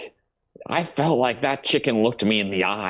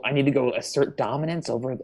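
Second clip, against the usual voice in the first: ordinary delivery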